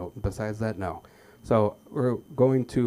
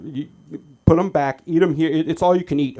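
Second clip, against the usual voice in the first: louder, higher scolding; mock waiter scold; 7 semitones higher, 11 dB louder than usual. Waiter scolding the boy over cookies, loud scold. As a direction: lecture the greedy kid.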